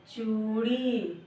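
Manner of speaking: teacher giving the answer very slowly; slow drawn-out answer. Shown as a demonstration. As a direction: stretch चूड़ी out slowly like a class chorus. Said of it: "क्या हुआ?".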